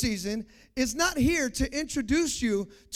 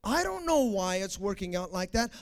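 ordinary talk, nothing marked

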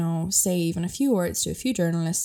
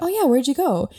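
higher, louder show of polite interest; mock interested; 8 semitones higher, 5 dB louder than usual. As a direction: ask brightly as if truly curious. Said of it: "I was like".

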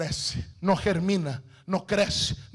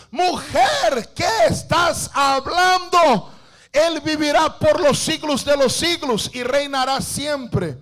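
indignant shout; 4 semitones higher than usual. Acted. Husband shouting in acted quarrel.

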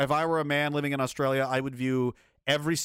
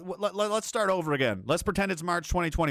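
usual speaking voice

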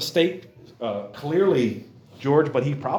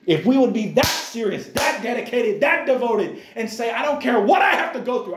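fervent preaching; 8 semitones higher than usual. Acted. Preacher building to full force.

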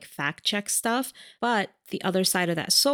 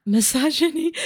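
higher, louder echo of the word Misogyny; emphatic echo; 9 semitones higher, 5 dB louder than usual. Real, not acted. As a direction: throw Misogyny back with gusto. Echoes co-host emphatically.

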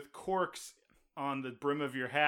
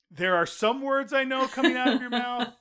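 exasperated; 9 semitones higher, 7 dB louder than usual. Retold or imitated. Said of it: "I was like".

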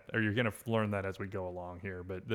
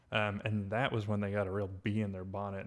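ordinary talk, nothing marked

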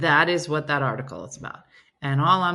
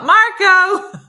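loud, high-pitched call-out; mock yell; 12 semitones higher, 12 dB louder than usual. Joking about yelling at polo, big mock yell. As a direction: belt it out like a pool-game shout. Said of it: "How are they gonna yell".

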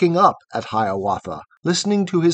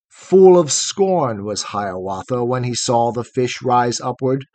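ordinary delivery, nothing marked

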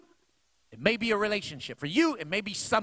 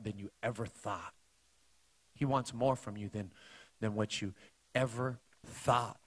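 much lower and softer, intimate; hushed; 10 semitones lower, 7 dB quieter than usual. Real, not acted. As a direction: draw them close, almost breathing it. Preacher drops to hushed appeal.

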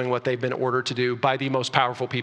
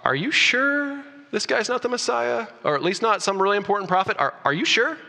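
subtle doubting question; 6 semitones higher than usual. Retold or imitated. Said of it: "they're saying to the religious leaders".

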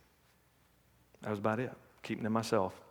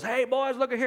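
hearty dad; 10 semitones higher than usual. Retold or imitated. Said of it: "their dad walks in and goes".

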